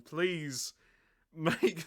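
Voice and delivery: normal talking voice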